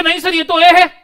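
high, loud insistent student; protesting student. Retold or imitated. Teacher voicing students insisting it's an A, high and loud. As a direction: object like a sure student. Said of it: "आप बोलोगे".

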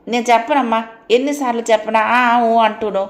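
put-on fed-up girl shouting at her mother; exasperated shout; daughter yelling. A narrator's reading. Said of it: "గట్టిగా అరిచింది సీత".

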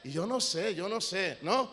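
very loud shout of "por fe"; thundering pulpit shout; shouted refrain. Acted. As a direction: bellow it at the congregation.

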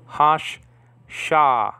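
hash, sha, said slowly and split apart; Hebrew syllables. Shown as a demonstration. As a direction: say hash, then sha, as two clear syllables. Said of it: "like in the word father".

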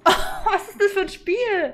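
high, slow, laughing question; amused, loud laughing; laughing disbelief. Real, not acted. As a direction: laugh through the words in disbelief. Laughing at bizarre game.

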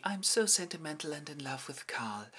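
mock the dreamy lovesick girl; mock sentimental. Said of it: "doing her Amalia thing".

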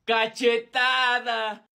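shouted slowly and drawn out; slap announced. Acted. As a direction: call the penalty out loud, stretching it. Juego de besos, castigo anunciado. (anuncio gritado y lento)

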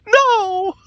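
very high, loud shrieks; exasperated screaming. Real, not acted. Player reacting to a failed roll, exasperated high screams. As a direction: screech it out in real frustration. Wails over bad dice roll.

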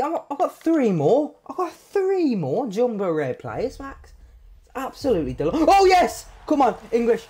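high, thrilled and rising; excited. Real, not acted. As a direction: build into a cheer. More packs, big pull.

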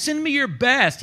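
high, loud taunt with amusement; acted scornful challenge; mocking giant. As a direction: jeer it out, pitched high and loud. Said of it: "he's laughing".

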